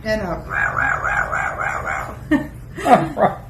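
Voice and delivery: high rah rah barking coughs; coughing demo. Shown as a demonstration. Owner showing her parrot a sore-throat cough, high barking rahs. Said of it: "supposed to be a sore throat".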